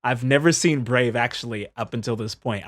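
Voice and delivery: ordinary talk, nothing marked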